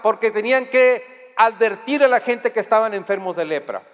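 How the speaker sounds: ordinary talk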